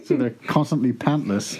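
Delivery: usual speaking voice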